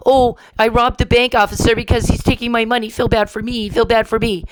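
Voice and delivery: high, whiny pleading voice; mocking a self-pitying criminal's whine; mock whining. Ranting about the man she accuses, mocking his whiny self-pity. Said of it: "go around whining and crying".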